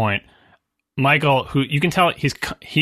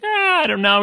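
high dismissive shrug; 13 semitones higher than usual. Retold or imitated. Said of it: "And he's like".

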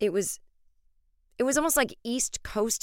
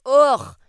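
loud exasperated ugh; a big loud groan of disgust; 6 semitones higher, 13 dB louder than usual. Real, not acted. Reaction to compliment.

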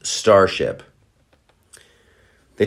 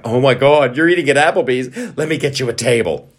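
a bit higher, louder gushing fan; gushing host; 5 semitones higher, 3 dB louder than usual. Retold or imitated. Restaurant host gushing over a celebrity who walks in.

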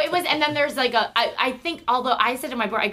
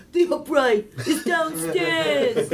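higher, slow, drawn-out grandpa voice; grandpa impression; 7 semitones higher, much slower than usual. Shown as a demonstration. Imitating her grandpa for the hosts, exaggerated voice. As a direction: drag it out as a comic grandpa, half laughing. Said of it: "I'll do my grandpa. My grandpa's like".

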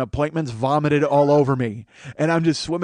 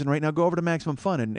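ordinary talk

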